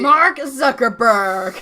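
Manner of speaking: loud, slow drawn-out gleeful shout; excited callout. Real, not acted. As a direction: bellow the name like a punchline.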